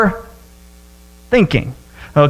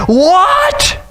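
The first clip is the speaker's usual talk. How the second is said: shocked what; 10 semitones higher than usual. Retold or imitated. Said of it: "they're just like".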